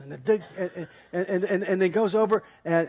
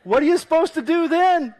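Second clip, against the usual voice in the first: higher and louder, throwing up his hands; helpless Ken; 9 semitones higher, 7 dB louder than usual. Retold or imitated. Ken back home, asking what else he could do, exasperated. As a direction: shrug it out loud. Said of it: "He's like".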